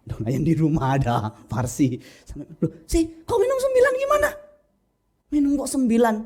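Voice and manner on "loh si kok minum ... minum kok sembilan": very high, incredulous outburst; alarmed scolding. Acted. Preacher to younger sibling over the pills, shocked high scolding. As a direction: act out shocked disbelief, pitched way up.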